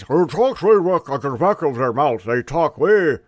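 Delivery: exaggerated, swallowed Beijing Chinese accent; mock Beijing accent. Shown as a demonstration. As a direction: talk from way back in the mouth, parodying Beijing speech. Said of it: "Beijing Chinese. ... That's how they pronounce their words".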